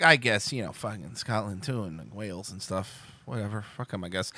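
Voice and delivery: low, soft, trailing mumble; imitating half-hearted indifference; dismissive afterthought. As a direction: toss it off as a grudging afterthought. Everyone grudgingly adds Scotland, Wales.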